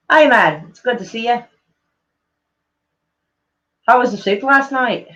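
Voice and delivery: put-on a slightly off Scottish accent, drifting to Belfast; attempted Scottish accent. Streamer greeting someone in an attempted Scottish accent. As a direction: try a Scottish accent that slips into Northern Irish. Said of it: "coming out more like Belfast".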